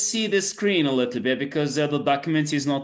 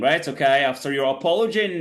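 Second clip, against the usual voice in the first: usual speaking voice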